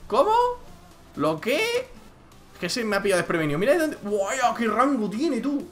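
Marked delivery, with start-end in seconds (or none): 0.1-1.9 high startled question; shocked disbelief; surprised. Real, not acted. Surprised at a sudden play. 3.6-5.7 real amazement, much higher; excited. Real, not acted. Amazed by a long shot.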